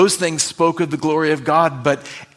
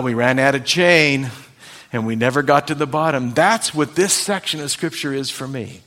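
ordinary talk, nothing marked

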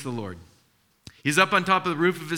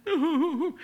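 put-on child voice; 13 semitones higher than usual.

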